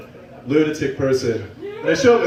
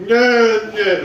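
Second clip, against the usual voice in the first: tongue-tied; 4 semitones higher, 3 dB louder than usual. Retold or imitated. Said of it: "I could not talk at all".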